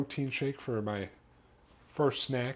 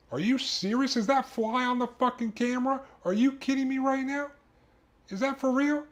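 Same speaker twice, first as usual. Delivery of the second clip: real exasperation. Real, not acted. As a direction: shriek in disbelief at the fly on the camera. Fly lands on camera, outburst.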